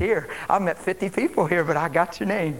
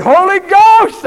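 high, drawn-out, exultant shout; re-enacted ecstatic yell; real loud shout; 13 semitones higher, 18 dB louder, slower than usual. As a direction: holler it at full volume. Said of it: "I shouted real loud".

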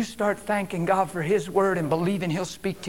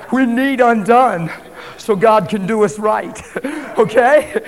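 loud, raised voice breaking into laughter; higher, louder, laughing as you go; exultant laughing; 4 semitones higher, 9 dB louder than usual. Real, not acted. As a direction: preach it big through a laugh. Exultant preaching through laughter.

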